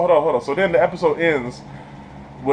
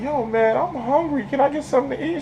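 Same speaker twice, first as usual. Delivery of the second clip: put-on whiny hungry kid; 9 semitones higher than usual. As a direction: ask for food pitched up.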